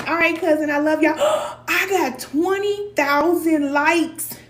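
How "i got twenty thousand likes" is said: gasps, then high and loud with delight; sudden joyful outburst, slow and high; real excited gasp. Real, not acted. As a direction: blurt it out, thrilled by the number. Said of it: "That's my happy dance".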